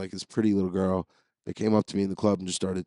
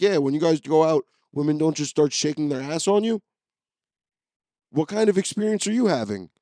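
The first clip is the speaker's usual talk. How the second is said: mock bewildered question; 6 semitones higher, 3 dB louder than usual. Mock asking confused friends.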